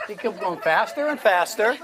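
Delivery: urgent, whipped-up hypnotist patter; excited showman build. Real, not acted. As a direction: drive the frenzy up over the laughing crowd. Showman builds frenzy, calls applause.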